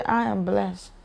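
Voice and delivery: soft, indifferent throwaway; just saying it. Shown as a demonstration. Believer saying a blessing only to say it, unfelt. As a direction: drop it quietly, without feeling. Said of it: "you just wanna say it".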